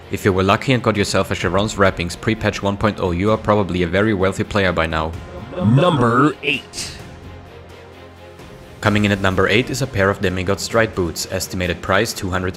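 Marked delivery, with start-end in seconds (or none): 5.5-7.0 slow, high dramatic announcer call; top ten countdown. Acted. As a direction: stretch it out like a game-show host. Top ten list countdown card.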